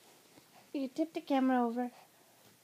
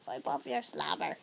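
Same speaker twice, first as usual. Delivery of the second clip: normal talking voice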